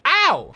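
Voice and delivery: high, loud yelp "Ow!"; mock pain yelp. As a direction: yelp like you hit the ground. Voicing the fall.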